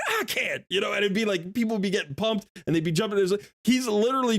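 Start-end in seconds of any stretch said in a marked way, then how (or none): 0.1-0.6 high eager answer; eager reply. Retold or imitated. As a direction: answer the call, higher. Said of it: "somebody else would be like".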